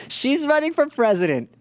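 ordinary talk, nothing marked

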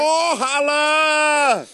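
high, slow, loud holler; loud sassy woman. Acted. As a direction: belt it out like a sassy auntie. S0 in character as woman.

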